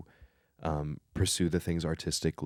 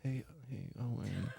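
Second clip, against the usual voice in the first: very soft, higher bedtime voice; playful sleepy hush; 4 semitones higher, 11 dB quieter than usual. Shown as a demonstration. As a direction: tease them gently at near-whisper level. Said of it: "start talking like this".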